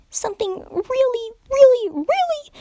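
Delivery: very high, bubbly cartoon pony voice; giddy high pony explaining her twitches; hyper Pinkie Pie. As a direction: chatter it like an excitable party pony.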